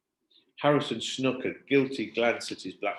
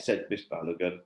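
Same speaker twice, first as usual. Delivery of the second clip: plain reading voice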